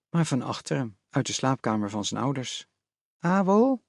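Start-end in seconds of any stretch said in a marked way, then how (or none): 3.2-3.8 higher, lisping questioning voice; a deer calling the boy's name in his mother's voice. A narrator's reading. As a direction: sound like you've just come from the dentist. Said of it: "ze klonk vreemd, lispelend".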